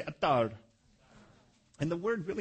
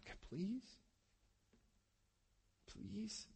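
much softer, pleading little "please"; soft begging; 6 semitones higher, 13 dB quieter, much slower than usual. Retold or imitated. Preacher voicing Isaac begging God, soft pleading. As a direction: beg God quietly, almost breaking. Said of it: "he's begging God".

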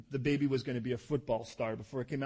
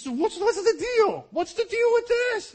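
much higher, much louder, slow squealing complaint; fed-up pregnant wife; 13 dB louder, slower than usual. Pretend. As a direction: shriek it as a wife at her wit's end. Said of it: "She says".

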